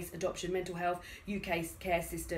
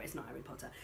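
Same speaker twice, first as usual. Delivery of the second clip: much softer, dropping to a low mutter; muttered aside; 7 semitones lower, 12 dB quieter, faster than usual. Real, not acted. Reviewer muttering her verdict on the Harry Potter play, softly. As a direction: say it under your breath, resigned. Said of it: "it's, it's not Harry Potter".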